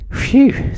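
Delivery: whispered high sigh of relief; boy's breathy phew; relieved phew. A narrator's reading. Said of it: "said Jack".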